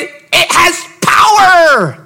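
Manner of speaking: power drawn out, slow and shouted; stretched preaching shout. Acted. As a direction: hold every word as a climax. Climax of the sermon point.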